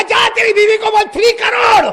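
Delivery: loud high scoffing dare; shouted mocking number. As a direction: shout it as an absurd joke.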